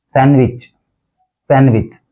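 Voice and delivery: सैंडविच as SAN-wich; सैंडविच going straight from N to W; silent D. Shown as a demonstration. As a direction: drop the D from सैंडविच. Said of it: "डी जो है वो silent है".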